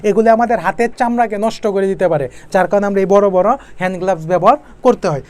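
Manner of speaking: ordinary talk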